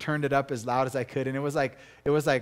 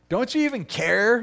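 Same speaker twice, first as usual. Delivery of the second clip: higher, louder whiny accusation; whiny complaint; 8 semitones higher, 8 dB louder than usual. Retold or imitated. Disciples complaining to Jesus, whiny mock accusation. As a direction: whine it like a hurt friend in a fight.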